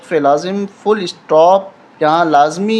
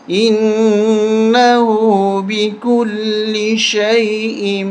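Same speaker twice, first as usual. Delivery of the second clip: slow melodic tajweed recitation; Quranic recitation; 2 semitones higher, much slower than usual. Shown as a demonstration. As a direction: recite it slowly, drawn out, like a qari. Recites verse. (تلاوت کے انداز میں آہستہ)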